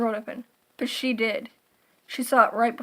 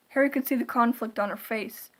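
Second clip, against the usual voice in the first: usual speaking voice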